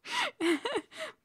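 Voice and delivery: real high-pitched laugh; amused laugh. Real, not acted. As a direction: burst into a short, high giggle. Reader amused by own line.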